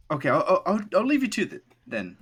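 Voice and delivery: high, loud nervous stammer. Acted. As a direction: stumble out an awkward exit. Alan nervously leaves.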